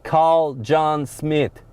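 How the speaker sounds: very slow, separated words; voice command. Real, not acted. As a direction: dictate it slowly to a machine. Voice command to the car system.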